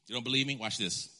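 ordinary talk

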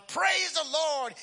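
high, drawn-out whoop of praise. Acted. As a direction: shout it jubilantly to the heavens. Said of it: "shouting even louder".

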